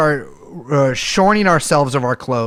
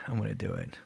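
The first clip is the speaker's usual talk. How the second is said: much softer, nervous self-pep; nervous quiet; 7 semitones lower, 17 dB quieter, faster than usual. Real, not acted. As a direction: murmur it to yourself nervously. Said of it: "performance anxiety".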